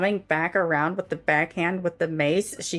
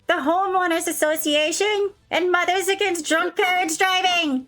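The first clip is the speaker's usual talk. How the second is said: high, loud, proud Karen declaration; 10 semitones higher, 4 dB louder than usual. Acted. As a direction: declare it like a self-righteous crusader. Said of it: "She goes".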